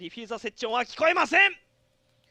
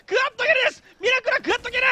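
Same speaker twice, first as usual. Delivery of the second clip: excited shout; 9 semitones higher, 5 dB louder, faster than usual. Real, not acted. Quad kill called by caster.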